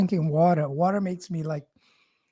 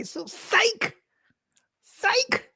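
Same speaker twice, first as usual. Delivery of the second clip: high squealed excitement; excited squeal; 3 dB quieter, much slower than usual. Acted. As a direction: squeal it out, hyped up.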